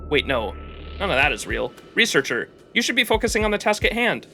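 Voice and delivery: ordinary delivery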